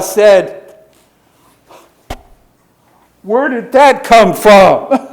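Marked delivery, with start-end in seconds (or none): 3.2-5.1 higher, louder, slow laughing disbelief; amused surprise. Retold or imitated. Marcella caught swearing at dominoes, laughing in surprise. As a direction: marvel at yourself, half laughing. Marcella shocked at her own slip.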